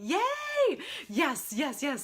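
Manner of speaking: very high, loud cheer; excited yay. Real, not acted. Host cheering a viewer joining live, excited. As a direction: cheer like a fan. Greets arriving viewer Peggy.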